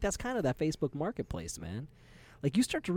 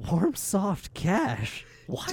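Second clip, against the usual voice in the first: baffled seller; 6 semitones higher, much slower than usual. Retold or imitated. Confused seller on the phone hearing the odd offer, slow disbelief. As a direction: stretch it out like a stunned stranger. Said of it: "picturing this other guy on the other end".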